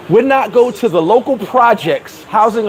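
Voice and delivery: normal talking voice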